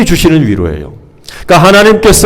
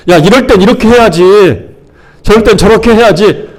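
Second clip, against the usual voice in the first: higher, know-it-all advising; pushy advice; 5 semitones higher than usual. Acted. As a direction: tell them insistently how it should be done. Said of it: "무조건 충고하는 거예요". (무조건 충고하는 높은 말투)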